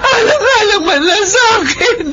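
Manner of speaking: high, crying plea for her son; tearful plea. Acted. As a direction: beg your daughter through tears. Mother begs for son.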